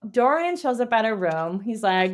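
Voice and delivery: ordinary talk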